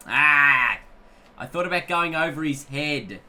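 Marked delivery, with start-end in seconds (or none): 0.1-0.8 sudden loud cry of dismay; real burst of frustration at the shot; frustrated yell. Real, not acted. Mistimed shot in cricket game.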